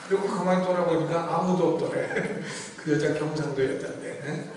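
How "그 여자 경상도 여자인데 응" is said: ordinary delivery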